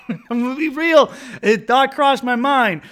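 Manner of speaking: loud, high, laughing through the words; laughing confession. Real, not acted. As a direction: confess it while still cracking up.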